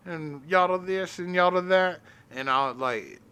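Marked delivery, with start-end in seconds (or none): 0.0-2.0 higher-pitched cowboy twang. Retold or imitated. Comic telling a friend about his stage act, in a cowboy voice. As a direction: talk like an old-west cowboy. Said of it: "I did the cowboy voice".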